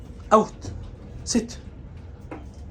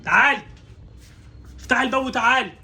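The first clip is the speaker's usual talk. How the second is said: higher, raised call to a dog; 4 semitones higher than usual. Real, not acted. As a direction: call the dog in from behind a closed door. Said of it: "ما تجيش غير اما اناديلك". (بينادي الكلبة بصوت مرفوع)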